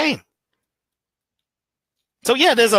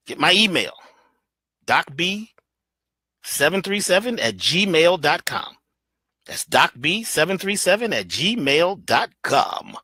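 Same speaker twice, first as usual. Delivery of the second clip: lower, slow, deliberate announcer voice; slow announcer read; 4 semitones lower, slower than usual. Shown as a demonstration. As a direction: read the email out like a radio spot, slowly. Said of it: "Why don't they talk like that".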